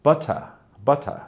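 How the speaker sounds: "butter" in a British accent, crisp t and no r; a lightly done British "butter". Shown as a demonstration. American teacher attempting a British accent for students. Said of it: "my English accent is very terrible".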